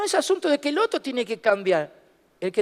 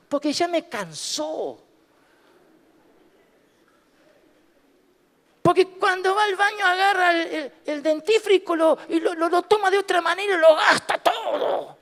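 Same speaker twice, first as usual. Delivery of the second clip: fed-up complaint; 7 semitones higher, 3 dB louder than usual. Retold or imitated. Spouse complains about toothpaste.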